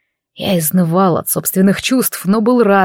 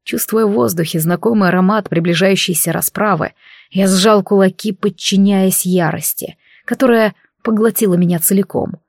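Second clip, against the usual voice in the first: usual speaking voice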